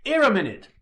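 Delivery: loud, high summoning call; calling over. A narrator's reading. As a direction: call someone across the room. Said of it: "wave my arm and call him over".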